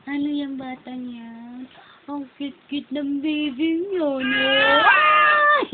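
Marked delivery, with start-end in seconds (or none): 4.8-5.7 sudden high, loud yelp of surprise; startled squeal. Real, not acted. Mother startled by the baby's outburst, squeals.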